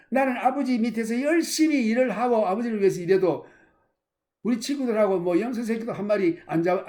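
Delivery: a jealous son protesting to his father; angry protest. Acted. (아버지에게 따지는 큰아들의 분한 목소리)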